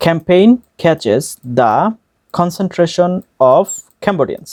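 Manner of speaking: very slow, with gaps between words. Shown as a demonstration. Teacher breaks the line down for learners, slow word by word. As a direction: break it up and pause after each chunk. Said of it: "একটা একটা করে word উচ্চারণ করে".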